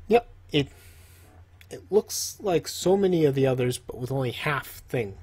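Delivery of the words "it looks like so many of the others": ordinary talk, nothing marked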